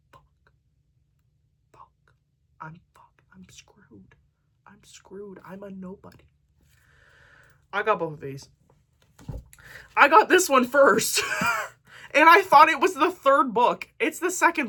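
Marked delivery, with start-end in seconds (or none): 0.1-6.3 whispered, breathless "Fuck" and "I'm screwed"; panicked whisper. Real, not acted. Booktuber fears a copyright strike, whispering. 10.0-13.5 louder, higher burst of excitement; excited outburst. Real, not acted. Booktuber shows off her first purchase.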